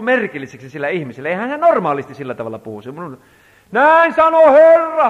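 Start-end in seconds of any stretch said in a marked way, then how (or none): 3.7-5.1 much higher, louder, slow eerie prophetic call; child's prophetic cry. Retold or imitated. Father imitating his small son crying out a prophecy, strange high voice. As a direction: cry it out like a voice not his own. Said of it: "hän rupesi huutaa sieltä".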